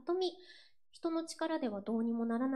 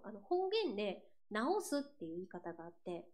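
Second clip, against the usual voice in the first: normal talking voice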